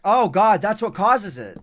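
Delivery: loud, high burst of sudden discovery; exasperated realization. Real, not acted. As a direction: cry out as the cause hits you. Discovers cause of the mistake.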